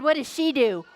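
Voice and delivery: usual speaking voice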